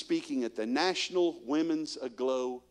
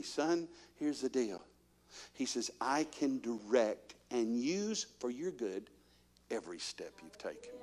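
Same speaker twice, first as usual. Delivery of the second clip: softer and slow, reassuring; slow gentle Lord; 3 semitones lower, 8 dB quieter, slower than usual. Retold or imitated. Preacher voicing the Lord reassuring him, slow and soft. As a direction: speak it slowly, like a father.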